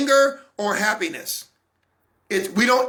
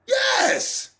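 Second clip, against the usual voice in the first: a very high squeaked yes; squeaky yes. Real, not acted. Answering friends' disbelief, squeaked high.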